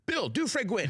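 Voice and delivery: high, excited showbiz voice; Martin Short impression. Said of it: "'cause Martin Short sometimes will just be like".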